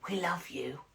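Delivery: a quiet aside of support; quiet hello. Retold or imitated. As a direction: say it softly so nobody hears. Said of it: "came up to say hello quietly".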